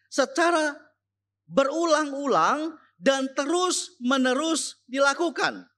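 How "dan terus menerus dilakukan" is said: normal talking voice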